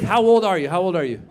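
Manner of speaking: ordinary delivery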